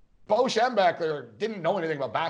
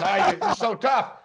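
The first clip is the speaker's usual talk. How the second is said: drawn-out, higher and louder mocking jab; sarcastic taunt; 5 semitones higher, 4 dB louder, slower than usual. Coach taunting a player who thinks he's badass, slow louder mockery. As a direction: needle him slowly about being tough. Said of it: "He goes".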